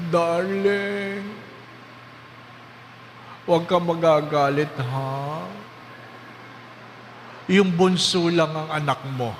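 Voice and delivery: a lightly voiced dying wife confessing. Wife confessing to husband on her deathbed. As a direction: confess gently, afraid of his anger. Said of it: "Sabi niya".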